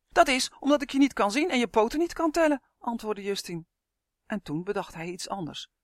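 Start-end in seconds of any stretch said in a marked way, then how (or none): 0.1-2.6 high, loud, frightened retort; agitated protest. A narrator's reading. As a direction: argue back loudly, still scared. Frightened Justin protests to creature. (luid en angstig protesteren)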